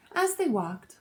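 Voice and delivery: usual speaking voice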